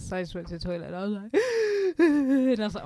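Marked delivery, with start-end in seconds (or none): 1.4-1.9 loud, high mock sobbing gasp; imitated crying gasp. Retelling crying in the work toilet, mock sobbing. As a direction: act out a toilet-cubicle cry. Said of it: "I just had to cry".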